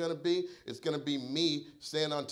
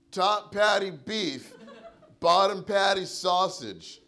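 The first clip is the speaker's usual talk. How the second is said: slow, loud and higher, hammering each word; angry yell; 6 semitones higher, 5 dB louder, slower than usual. Retold or imitated. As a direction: spell out the outrage word by word. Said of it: "I was like".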